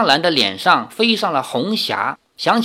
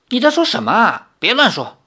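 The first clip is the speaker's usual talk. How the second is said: high, flustered denial; hasty protest; 7 semitones higher than usual. A narrator's reading. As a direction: cut in quickly to hush her. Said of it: "李逍遥连忙说". (慌忙高声制止)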